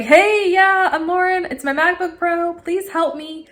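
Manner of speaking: high-pitched, eager phone patter; stoked. Retold or imitated. Dripping customer to a new support guy, stoked. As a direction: keep it high and bubbly, barely contained. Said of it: "I'm so stoked".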